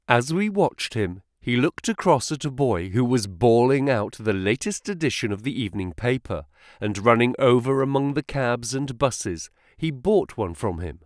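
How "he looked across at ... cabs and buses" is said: ordinary delivery, nothing marked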